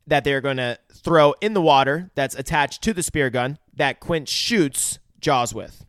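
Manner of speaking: usual speaking voice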